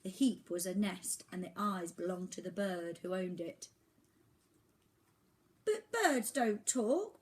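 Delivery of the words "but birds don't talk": put-on high, loud, slow boy's objection; protesting. Oliver protesting to the talking bird, high slow voice. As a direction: insist it can't be true. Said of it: "protested Oliver".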